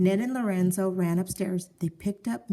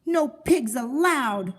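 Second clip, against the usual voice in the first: slow, higher, louder decree; shouting; 6 semitones higher, 4 dB louder, slower than usual. A narrator's reading. Eugenia chasing the pig around the yard, shouting slowly. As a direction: spell out each word as a furious rule. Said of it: "shouted Eugenia".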